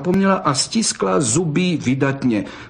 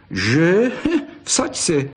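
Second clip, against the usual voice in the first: jeering laugh that jumps much higher; mocking laugh; 6 semitones higher, much slower than usual. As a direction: laugh at her, teasing. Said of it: "posmíval se Pepík".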